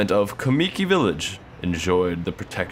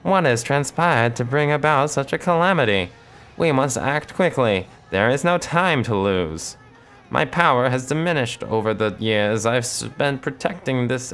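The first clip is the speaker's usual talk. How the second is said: raised, ethereal spirit voice; wise wood sprite; 6 semitones higher than usual. Acted. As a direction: speak as an ancient tree spirit. Voicing the tree spirit.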